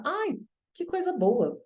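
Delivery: sing-song delight, imitating staff; mock sweet school staff; mock gushing. Retold school staff praising him.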